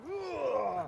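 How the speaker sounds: high groan of bending down; mock groan of an ageing back; imitated effort groan. Man joking about bending down, imitated groan. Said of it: "every time I bend down".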